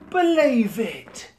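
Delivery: a huge, high, slow bellow of triumph; triumphant shout. Acted. Zombie knight bursting from the dragon, shouting triumphantly. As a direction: roar it out as the victorious knight. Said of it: "Sir Aegon shouted".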